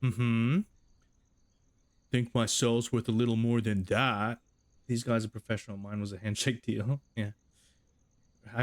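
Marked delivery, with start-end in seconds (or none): 0.0-4.4 smug bargaining jock. Acted. Doug haggling, put-on voice.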